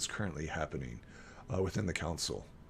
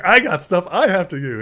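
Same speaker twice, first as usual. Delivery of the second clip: loud, high comic protest; hammed-up imitation insisting on his own business; mock Ulfgar; 9 semitones higher, 13 dB louder than usual. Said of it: "Ulfgar's like".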